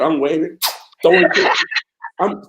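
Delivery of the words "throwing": usual speaking voice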